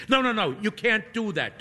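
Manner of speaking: high, flustered priest's protest; panicked no, no, no; exclaiming. A narrator's reading. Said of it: "Toby exclaims".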